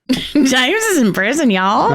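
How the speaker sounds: laughing through a put-on Southern twang; mock Southern accent. Shown as a demonstration. Cohost joining in with a laughing Southern accent. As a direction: drawl it out like a Southern storyteller. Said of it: "only gonna come out southern".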